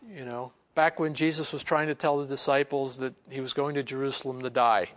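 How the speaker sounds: normal talking voice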